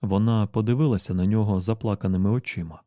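ordinary delivery, nothing marked

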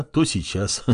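usual speaking voice

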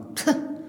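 a quiet, unvoiced little laugh; soft short laugh. Widow laughing off the priest's surprise, soft short laugh. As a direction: laugh it off gently under the breath.

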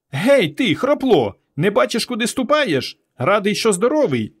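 put-on high-pitched little boy picking a fight; cocky boy's cry. A narrator's reading. Small boy challenges the one trampling sandcastles, cocky. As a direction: call out cockily in a high child's voice. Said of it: "задирикувато крикнув".

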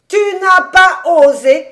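loud, high astonished question; incredulous. A narrator's reading. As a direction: throw it back in disbelief. Rabbi astonished at Fritz's timidity.

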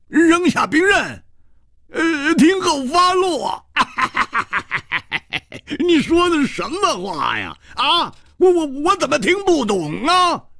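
sneering, laughing defiance; mocking bold hero. A narrator's reading. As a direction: laugh at the order and toss it back with scorn. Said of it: "胡铁花仰天大笑".

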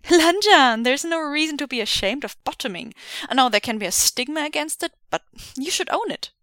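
bright, loud teasing; teasing grin. Acted. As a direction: grin through the whole line. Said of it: "grin widening".

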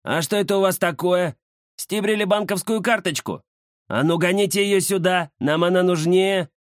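high, pushy street thug; greedy bandit voice. Acted. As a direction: demand it like a cocky thug. Bandit demands card, high voice.

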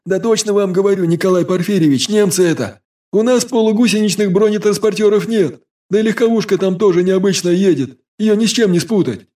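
put-on high, urgent boy's voice; breathless young messenger. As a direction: report it in a rush of alarm. Vaska insists, voiced character.

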